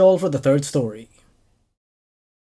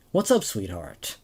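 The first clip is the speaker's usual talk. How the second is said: kind greeting; 10 semitones lower, 7 dB quieter than usual. Acted. Stranger in a towel gently greets the girl, soft and kind. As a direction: ease it out gently, like a fond father. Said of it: "He looked at me kindly and said".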